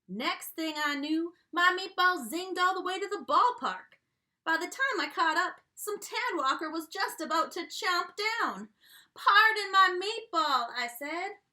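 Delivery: drawling cowboy storyteller voice; a jolly country restaurant owner spinning his yarn; folksy dog chef. Acted. Reading to kids as Yodeler Jones, folksy country voice.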